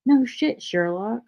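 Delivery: mock-obvious sarcasm, starting high then dropping; high-pitched start, dry sarcastic landing. Streamer quoting their own joking comments, sarcastic. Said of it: "it's just sarcasm".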